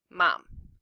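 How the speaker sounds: ordinary delivery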